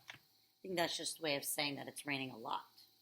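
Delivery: usual speaking voice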